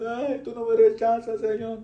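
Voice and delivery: high, put-on tearful voice; mock weepy prayer. Preacher imitates a crying worshipper to mock him, high mock sob voice. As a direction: ham up a sobbing believer praying. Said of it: "Ay, estás llorando".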